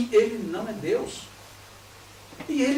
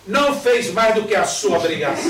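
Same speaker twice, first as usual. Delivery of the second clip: louder, curt mother's rebuke; stern scolding mother; 8 dB louder than usual. Retold or imitated. Mother dismissing daughter's passed exams, loud stern rebuke. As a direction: slap the praise down, louder.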